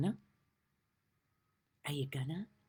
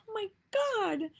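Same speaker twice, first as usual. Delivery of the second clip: laughing amazement. Retold or imitated. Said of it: "I was like".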